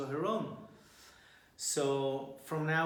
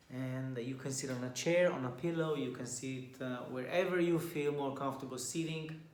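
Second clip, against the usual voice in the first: softer and softer as the breathing cues come; guided meditation; 4 dB quieter than usual. Acted. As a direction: gently talk the group into stillness.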